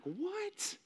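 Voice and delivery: very high, soft incredulous squeak; mock disbelief. Son asked his name by long-absent dad, mock disbelief. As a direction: squeak it out, stunned by the question. Retold reaction to dying dad's question.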